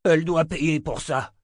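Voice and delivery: high, loud, furious; vengeful demand. Acted. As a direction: demand she pay for it. Wounded man demands revenge.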